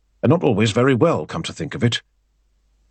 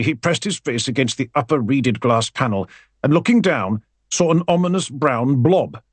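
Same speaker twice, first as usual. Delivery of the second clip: ordinary delivery, nothing marked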